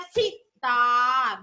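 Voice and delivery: put-on very slow, drawn out for children to copy; slow model word. Shown as a demonstration. Teacher modelling the word slowly for pupils. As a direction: say it slowly so pupils can repeat. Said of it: "तो क्या बन गया".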